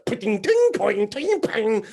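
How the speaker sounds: high, loud pinging sound effects; ping out each flying toenail like a ricochet. Retold or imitated. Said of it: "just go".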